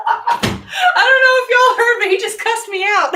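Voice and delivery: high, loud laughing exclaim; loud high amused report; excited laughing outburst. Real, not acted. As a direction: burst out amused. Laughing about pet cussing.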